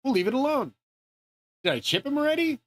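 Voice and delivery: high-pitched imagined inner voice; lightly mock a puzzled thinker, pitched well up; Elon. Imagining the chip maker's thoughts, high puzzled mock voice. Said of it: "Elon was probably thinking".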